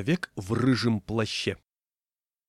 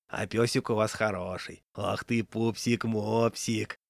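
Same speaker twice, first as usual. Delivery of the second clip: put-on slow cooing baby talk to a dog; langsam, zärtlich säuselnd; slower than usual. A narrator's reading. Man coos at the dog.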